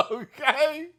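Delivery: loud, high burst of laughter; telling the story and cracking up; big laugh. Real, not acted. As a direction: crack up hard.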